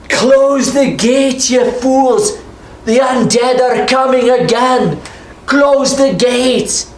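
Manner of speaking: high, slow, drawn-out yell; desperate shouted orders; Tick yelling. Acted. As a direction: bellow the order across the gate. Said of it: "you can hear Tick yelling".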